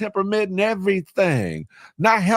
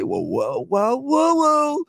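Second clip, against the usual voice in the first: playful mock alarm; 8 semitones higher, slower than usual. Streamer teasing a viewer about winding down, playful mock alarm.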